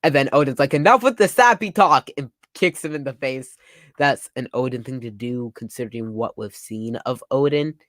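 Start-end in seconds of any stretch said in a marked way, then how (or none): 0.8-2.1 high, loud mock scolding; acted brusque outburst. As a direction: cut off the sentimental chatter. Voicing Oden cutting off sentiment.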